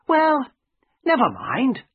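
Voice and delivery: put-on high, emphatic young friend's voice; friend Herbert, consoling. A narrator's reading. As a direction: brush it off in a high character voice.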